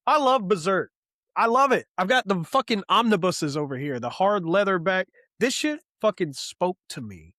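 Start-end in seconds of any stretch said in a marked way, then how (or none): 0.1-3.6 excited. Real, not acted. Gushing about the manga.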